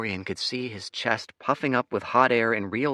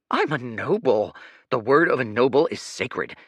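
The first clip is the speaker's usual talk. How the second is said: scandalized; 6 semitones higher, 7 dB louder than usual. Acted. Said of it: "Leo looked scandalized".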